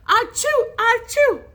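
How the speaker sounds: two high, loud fake sneezes; mimicked sneezes. A narrator's reading. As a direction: sneeze twice theatrically. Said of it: "Then again".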